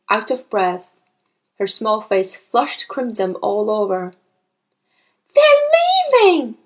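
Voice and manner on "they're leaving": put-on loud, high child's outburst; distressed little-girl cry; panicked cry. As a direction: blurt out alarming news. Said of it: "she cried".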